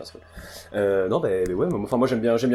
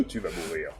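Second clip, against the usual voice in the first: villain laugh; 5 semitones lower, 12 dB quieter than usual. Acted. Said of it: "Ou le rire de méchant".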